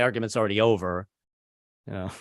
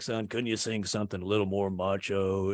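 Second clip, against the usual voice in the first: lightly voiced gruff dad; 5 semitones lower, 6 dB quieter than usual. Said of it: "my dad would come home".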